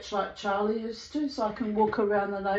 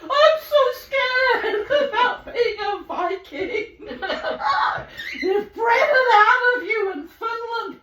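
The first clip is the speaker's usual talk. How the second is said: high, loud mock cry breaking with laughter; hammed-up wail through laughs; mocking outcry; 9 semitones higher, 6 dB louder than usual. Teasing the real Viking about being scared, loud mock outcry.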